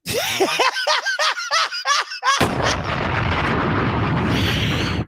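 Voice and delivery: high shrieking laugh that goes on; long high howling laugh. Real, not acted. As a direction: laugh helplessly at the memory.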